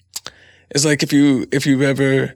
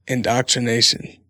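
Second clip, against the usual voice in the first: ordinary talk, nothing marked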